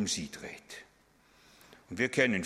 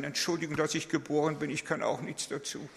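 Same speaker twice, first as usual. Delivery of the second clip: higher, meek apologetic voice; timid self-effacing; 6 semitones higher than usual. Pretend. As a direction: play the cringing doormat. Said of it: "solche Duckmäuser sein". (kleinlauter, hoher Duckmäuser-Ton)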